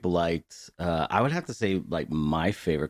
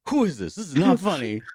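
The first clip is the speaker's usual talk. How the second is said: mock angry manager, higher and rising in pitch; 9 semitones higher, 5 dB louder than usual. Manager yelling at the prank caller, mock outrage pitched higher. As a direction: play the outraged manager getting higher each line. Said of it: "the manager's like".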